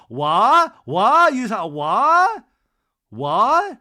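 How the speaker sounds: loud, high "wha" with the T missing; mock upset, rising "wha" left open at the end; Lisa. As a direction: blurt "wha", dropping every final T. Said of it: "can't pronounce those T's when she gets upset".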